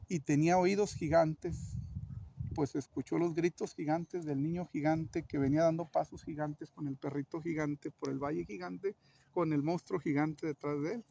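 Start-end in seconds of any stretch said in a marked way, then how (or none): none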